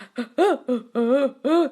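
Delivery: sharp gasp caught between laugh and sob; a sudden overwhelmed gasp; emotional gasp. Real, not acted. Host gasping as she recalls the meditation, overwhelmed gasp. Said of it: "was making me emotional".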